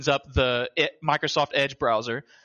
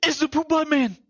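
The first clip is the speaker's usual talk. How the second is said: excited hailing; 12 semitones higher, 4 dB louder than usual. Acted. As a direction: call out in playful delight, pitched way up. Joins the excited mock greeting.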